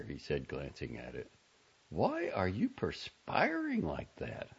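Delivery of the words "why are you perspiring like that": high, slow motherly demand; high-pitched mother questioning her son. A narrator's reading. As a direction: insist on an answer, high and drawn out. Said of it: "Missus Glass demanded".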